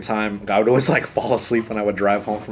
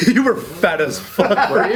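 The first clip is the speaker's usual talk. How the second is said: high, slow, cracking up in disbelief; incredulous, laughing, pitched up; laughing repeat; 9 semitones higher than usual. Real, not acted. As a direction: echo it through laughter. Laughing at friend's blunt remark.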